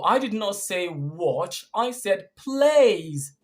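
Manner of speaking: slow correction, "plays" high and stretched; stress on plays. Shown as a demonstration. Correcting someone who heard watch, emphatic stress on "plays". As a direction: lean long and hard on "plays". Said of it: "we did not stress plays".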